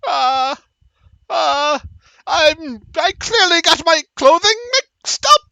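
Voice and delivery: high, flustered halfling excuse; stall, then blurt a high excuse; caught-out halfling. Acted.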